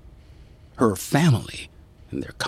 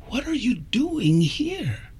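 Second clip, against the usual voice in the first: surprised; 11 semitones higher, 5 dB quieter than usual. Acted. Friend surprised at visitor.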